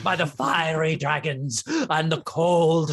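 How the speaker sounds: slow, grandiose kobold proclamation; self-important monster, drawing out each title; crazed kobold. Acted. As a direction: declaim like a deranged little dragon lord. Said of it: "foam around his mouth and snout, and he goes".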